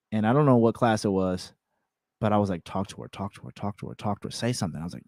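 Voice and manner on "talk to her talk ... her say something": hushed self-pep talk; quietly urging himself on; urgent inner whisper. Retold or imitated.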